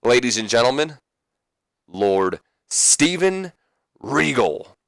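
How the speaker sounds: high, slow drawn-out introduction; ring announcer intro. Retold or imitated. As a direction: stretch the name out like an announcer. Shane introduces the commissioner.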